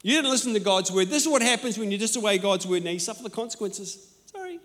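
mock self-righteous lecture; harsh judgmental rebuke. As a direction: scold him like a smug judge. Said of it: "He should have said".

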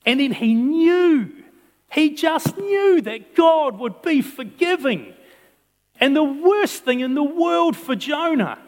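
high, loud, slow preaching; fiery pulpit sermon, drawn out; full-force preaching. Acted. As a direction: preach it out to the whole room.

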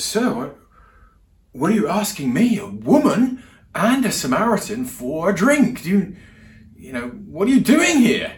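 imitating a shocked woman, loud and high. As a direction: act out loud, incredulous questions, pitch leaping up. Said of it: "she's quite shocked".

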